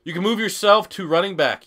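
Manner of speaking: usual speaking voice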